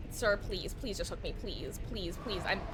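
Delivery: low, soft pleading; meek begging. Real, not acted. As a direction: plead quietly, worn out. Begging the killer to hook.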